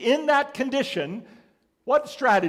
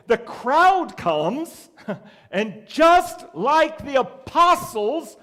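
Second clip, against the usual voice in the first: louder, slow and higher building preaching; rising fervour; 8 semitones higher, 9 dB louder, much slower than usual. Real, not acted. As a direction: push the crowd image with fervour.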